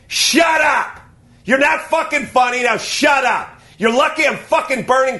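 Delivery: high furious yell; escalating comedy rant. Real, not acted. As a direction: scream it at the haters. Ranting at non-funny people.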